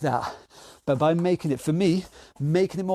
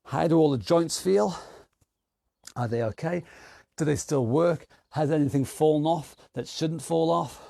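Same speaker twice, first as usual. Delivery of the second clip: ordinary delivery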